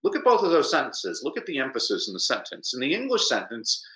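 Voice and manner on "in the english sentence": usual speaking voice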